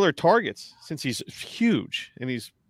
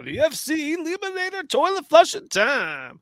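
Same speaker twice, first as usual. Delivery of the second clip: big high game-show announcer; 12 semitones higher than usual. Acted. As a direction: announce the segment title like a showman. Announcing a segment title.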